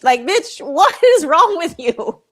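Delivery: loud, high, incredulous "what is wrong with you"; exasperated outburst. Real, not acted. Vlogger scolding lazy tourists, loud and incredulous. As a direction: call her out in disbelief.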